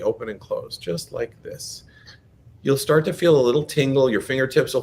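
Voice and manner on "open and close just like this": quiet. Shown as a demonstration. Hand demo, voice drops away.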